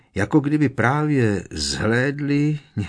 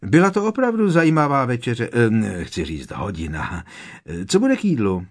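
higher, louder, forced cheerful chatter; much higher voice; 2 semitones higher, 5 dB louder than usual. Acted. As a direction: cover your shock with bright small talk, pitched up. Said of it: "pořád tím nepřirozeně vysokým hlasem".

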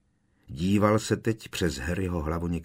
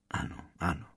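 soft, subdued admission; hushed reply; quietly; 6 dB quieter, much faster than usual. A narrator's reading. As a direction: answer softly, as if reluctant. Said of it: "ozval se tiše Brumbál".

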